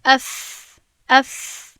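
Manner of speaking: اف, its final f held as an easy airy hiss; continuous fricative. Shown as a demonstration. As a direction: let the air flow freely through the ف. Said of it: "مثلاً اگر من بگویم".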